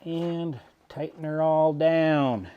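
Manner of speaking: southern accent. Real, not acted. Mechanic narrating his bolt tightening, southern accent. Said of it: "I just talked with a southern accent".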